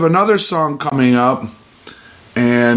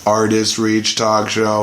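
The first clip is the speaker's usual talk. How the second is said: low, even android monotone; robot voice; 7 semitones lower than usual. Shown as a demonstration. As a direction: speak it like a machine. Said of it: "Like a robot".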